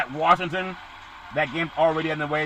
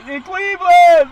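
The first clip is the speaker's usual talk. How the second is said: shouting Cleveland; 13 semitones higher, 11 dB louder, slower than usual. Acted. Hyping Cleveland's dog pound.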